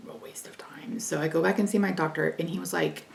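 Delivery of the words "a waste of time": whisper. Retold or imitated. Retold disappointment.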